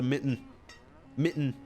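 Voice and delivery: "mitten" with the T swallowed, "mi'n"; unenunciated T. Shown as a demonstration. Streamer showing Michigan speech, unenunciated T in "mitten". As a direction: barely sound the T in "mitten". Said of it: "we don't enunciate our T's".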